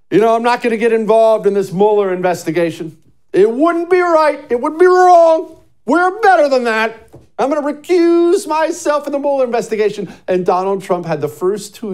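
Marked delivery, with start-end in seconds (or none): 0.1-10.1 mock-righteous proclamation, high and loud; Jeff Sessions. Host mocking the AG's recusal, loud high-pitched caricature. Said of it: "The first thing he does is".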